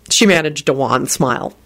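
plain reading voice

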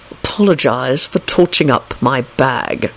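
slow, low haughty drawl. A narrator's reading. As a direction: demand it loftily, word by word. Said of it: "Snape replied haughtily".

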